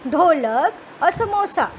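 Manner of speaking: ordinary talk, nothing marked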